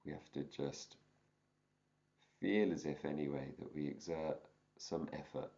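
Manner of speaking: calm teacher guiding a meditation; guided meditation. Acted. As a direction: ease them gently into the practice. Begins guided meditation practice.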